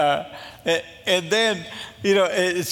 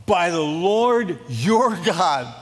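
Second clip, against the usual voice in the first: usual speaking voice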